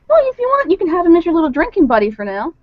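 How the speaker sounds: high, eager offer; so excited. Acted. Bar owner offers Kay the kitten as drinking buddy, excited. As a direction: pitch it up with bubbly eagerness. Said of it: "so excited about this".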